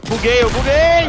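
real gamer frustration shout; excited shout. Real, not acted. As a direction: yell it out as the game freezes. Game glitch during a fight.

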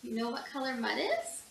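ordinary delivery

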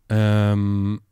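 ordinary delivery

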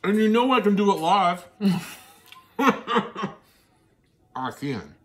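loud, high playful brag; cheeky loud boast to viewers; excited boast. Real, not acted. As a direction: brag it out to the camera.

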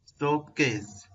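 ordinary delivery

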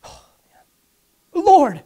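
acted exasperated cry to the Lord; breathy groan rising into a loud plea; stressed out. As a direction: play someone at their wits' end. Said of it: "they start getting stressed out".

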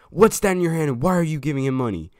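loud, high, accusing demand; teacher yelling. Retold or imitated. Math teacher confronting the kids in the bathroom, loud angry shout. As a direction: bust them out loud like a furious teacher.

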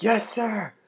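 high acted soldier's reply; eager military reply. Soldier accepting the minefield order, eager military reply.

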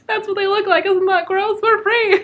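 high, loud mock cheer; breezy perky parody of the girl; chipper. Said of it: "so, like, chipper".

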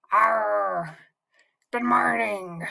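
gruff pirate growl. Shown as a demonstration. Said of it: "Can you say good morning like a pirate?".

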